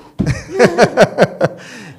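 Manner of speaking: high loud burst of laughter. Real, not acted. Pastor laughing at his own pig comparison, loud laugh. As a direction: laugh out at the joke.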